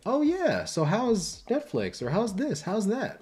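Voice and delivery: high, bright eager questions; eager friend. Retold or imitated. Friend quizzing the professional, excited and high. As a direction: pitch way up, suddenly interested. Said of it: "would be like".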